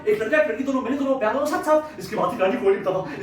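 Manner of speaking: usual speaking voice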